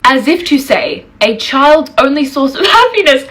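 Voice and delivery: real laughter creeping into the words; laughing. Real, not acted. Woman scoffing at commenters about her upbringing, laughing as she speaks. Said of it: "I'm laughing saying this".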